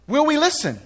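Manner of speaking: will we listen thrown up high and sharp; pointed repeat. Shown as a demonstration. Pastor repeating his challenge to the congregation, high and pointed. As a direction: fire the question back at them high. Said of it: "See, the question is".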